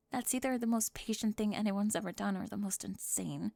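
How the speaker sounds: hushed, intimate cheerleader confessing to a classmate; soft roleplay confession. Acted. Soft-spoken roleplay confession scene.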